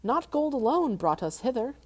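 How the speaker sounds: a small voice trying to sound bold; hobbit voice. Acted. Bilbo speaks again.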